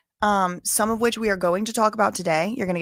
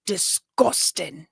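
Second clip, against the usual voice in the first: put-on slow Scottish-accented "Disgusting.", stretched out; a light take on how Scots say "Disgusting."; much slower than usual. Asking Scottish cousins if they say it, Scottish accent. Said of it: "is that Scottish".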